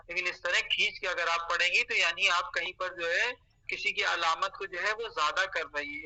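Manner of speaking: usual speaking voice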